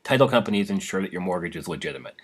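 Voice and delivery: ordinary talk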